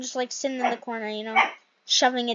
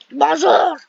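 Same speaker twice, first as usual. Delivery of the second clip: loud cheery Bonjour; 5 semitones higher, 9 dB louder than usual. Pretend. Greets herself as cafe worker.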